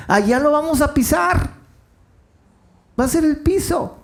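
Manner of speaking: excited preaching shout. Real, not acted. Preacher exults about golden streets.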